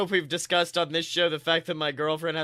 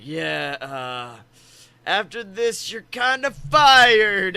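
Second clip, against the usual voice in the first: imitating Triple H; 2 semitones higher, 7 dB louder, much slower than usual. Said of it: "he's like".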